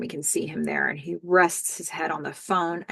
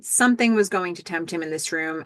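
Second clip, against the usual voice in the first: normal talking voice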